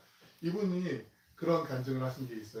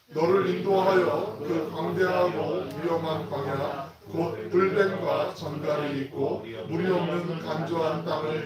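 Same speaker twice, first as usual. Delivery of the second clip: slow, loud, measured reading of the verse; slow unison scripture reading; 3 semitones higher, 8 dB louder, slower than usual. Acted. Pastor leading the congregation in reading Deuteronomy, slow and loud. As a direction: read the Word out together, slowly and loudly.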